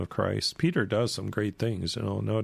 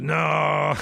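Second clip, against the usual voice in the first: drawn-out, higher, louder mock denial; emphatic denial; 6 semitones higher, 6 dB louder than usual. Peter denies knowing Jesus to a servant, emphatic denial. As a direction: act out a big protesting no. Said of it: "Peter even gets stronger every time".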